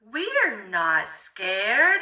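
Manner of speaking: ordinary delivery, nothing marked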